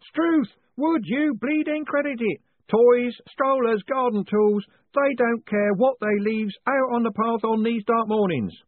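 high, far-off grumbling postmen; grumbling postmen chorus. A narrator's reading. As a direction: chant the gripe like old postmen together. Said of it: "Voices sang out from the darkness in chorus".

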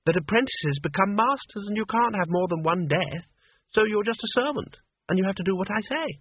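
put-on high-pitched, bossy girl; high, imperious telling-off without real shouting. A narrator's reading. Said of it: "in a loud voice".

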